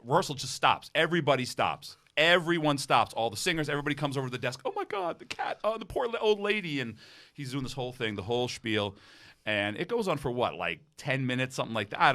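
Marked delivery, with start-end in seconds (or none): 4.6-6.8 high, hushed imitation of shocked onlookers; hammed-up high-voiced pity; mock horrified sympathy. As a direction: coo in mock dismay, pitched way up. Imitating singers' horrified sympathy for cat.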